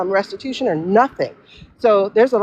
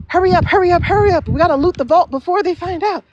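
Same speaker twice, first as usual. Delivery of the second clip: mock panic; 9 semitones higher than usual. Imitating panicked officials.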